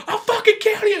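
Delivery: high, loud threatening yell; mock threat. Seller threatening the soldier, high loud yell. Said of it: "like, thing, like".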